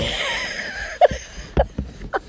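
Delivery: high breathless laugh; burst of laughter. Real, not acted. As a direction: laugh out, caught off guard. Dog's sudden move, oops.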